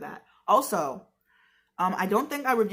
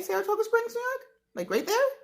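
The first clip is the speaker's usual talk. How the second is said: surprised; faster than usual. Real, not acted. Surprised the town is nearby.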